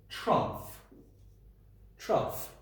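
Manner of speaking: trough with the gh said as f. Shown as a demonstration. Teacher revealing the puzzle word. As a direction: say trough ending in an f sound. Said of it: "that makes a f".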